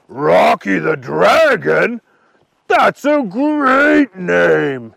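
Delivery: loud, slow, drawn-out dragon voice; delighted dragon. A narrator's reading. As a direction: savor the name, stretching it out. Dragon delighted by new name.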